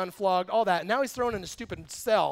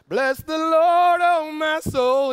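high, loud and slow, like a joyful hymn line; an imitated prisoner praising God loudly and slowly; mock pious praise; 8 semitones higher, 9 dB louder, much slower than usual. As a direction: proclaim it with slow, hammed-up devotion. Paul praising God in his cell.

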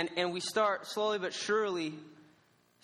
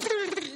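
high burst of laughter; 14 semitones higher than usual. Real, not acted. Laughing at his own defeat.

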